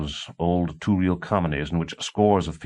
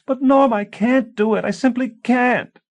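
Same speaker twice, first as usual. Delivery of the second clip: high, loud rising plea; rising, desperate; 7 dB louder than usual. A narrator's reading. A wife crying out to her husband in high panic. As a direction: voice climbing in panic. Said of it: "Her voice began to rise".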